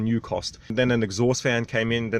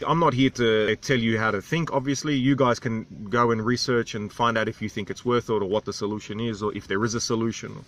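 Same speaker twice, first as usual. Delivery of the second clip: ordinary talk, nothing marked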